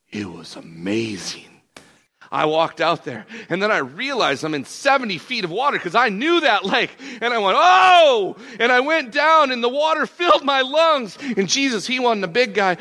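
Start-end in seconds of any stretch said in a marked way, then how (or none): 3.5-7.6 higher, urgent storytelling; mounting panic. Acted. Retelling the moment of sinking. 7.6-8.4 loud high "oh" of alarm; panicked yell. Acted. Acted cry as he sinks. 8.6-12.7 high, urgent, loud storytelling; panicked retelling. Acted. Retelling nearly drowning.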